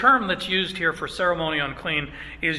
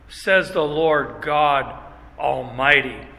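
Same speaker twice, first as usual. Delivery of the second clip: slow, weighty, lower close; strong conclusion; 3 semitones lower, 3 dB louder, slower than usual. Acted. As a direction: land it with solemn strength. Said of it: "the strength of which he concludes".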